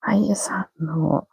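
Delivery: slow, whispered puzzlement; puzzled whisper. Real, not acted. Host whispers, baffled that the links fail.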